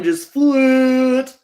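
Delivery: high, loud, slow self-mockery; hammed-up admission of singing flat; mock dramatic. Real, not acted. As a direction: overplay the confession.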